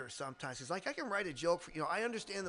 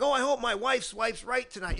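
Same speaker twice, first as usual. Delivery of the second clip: loud, high, hammed-up joke delivery; corny comic voice; 7 semitones higher, 9 dB louder than usual. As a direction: punch out a cheesy one-liner.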